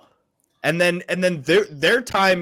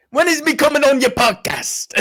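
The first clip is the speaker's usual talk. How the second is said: put-on Irish accent; 9 semitones higher than usual. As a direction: play the Irish fighter thanking fans. Imitating Irish fighter asking Rogan.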